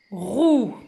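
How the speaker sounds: "roue" read loud and long, "rrouuu"; blended syllable. Shown as a demonstration. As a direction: join the R of "roue" to a held vowel. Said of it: "je vais lire ici". (« roue » lu lié, allongé)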